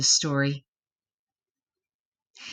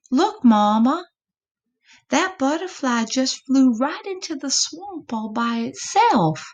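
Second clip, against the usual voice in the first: high, eager little possum calling to his mama; a small excited possum child, pitched up; young possum voice; 6 semitones higher than usual. Acted. Epossumandus talks to Mama.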